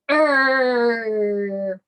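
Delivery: loud, drawn-out "err" growl; bear growl. Acted. Teacher showing what a bear says, playful growl. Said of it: "A bear says".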